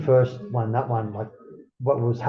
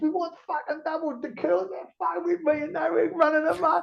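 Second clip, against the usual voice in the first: high, laughing through the words; can barely hold the laugh while telling; laughing over the bar tab prank; laughing story. Real, not acted.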